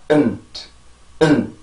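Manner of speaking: 은트 with the t barely touched, no puff; weak final t. Shown as a demonstration. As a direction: let 은트 end on leftover air. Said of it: "은트 이렇게 발음을 해줘".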